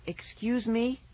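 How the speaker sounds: high, incredulous question; crying out. A narrator's reading. Girl reacting to the ghost dog, crying out. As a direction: pitch it right up in disbelief. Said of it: "Fergie cried".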